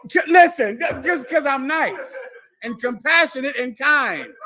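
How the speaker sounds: high, insistent, playful proclamation; loud mock boast. Asked to say it again, loud insistent repeat.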